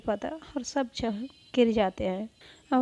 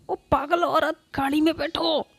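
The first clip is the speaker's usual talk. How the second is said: a sharp, louder order; shouting; 6 semitones higher, 5 dB louder than usual. Acted. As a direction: yell at her to get in the car. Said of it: "Avantika चिल्ला के कहती". (चिल्लाकर हुक्म)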